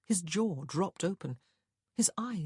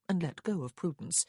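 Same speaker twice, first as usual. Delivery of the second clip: plain reading voice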